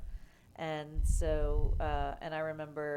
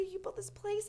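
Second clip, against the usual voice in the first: high-pitched, softer admiring gush; 7 dB quieter than usual. Retold or imitated. New comic praising the club owner to her face, gushing. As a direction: imitate a starstruck fan's high gushing. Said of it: "I was just gushing all over her".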